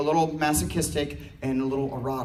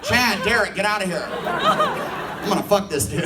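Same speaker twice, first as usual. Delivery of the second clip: mock excited friend; 4 semitones higher, 5 dB louder, slower than usual. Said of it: "That dude will be like".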